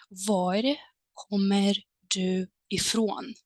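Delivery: Var kommer du ifrån said slowly, whispered and drawn out; slow clear model question. Shown as a demonstration. Teacher slowly modelling Var kommer du ifrån for learners. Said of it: "you might as well use the following structure".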